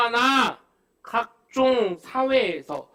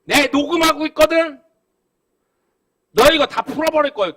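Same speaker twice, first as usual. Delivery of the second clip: louder and higher, rushing the threat; mock angry threat; 6 semitones higher, 9 dB louder than usual. Voter warning the councilman he is recording, loud mock threat. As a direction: bark the threat like you mean it.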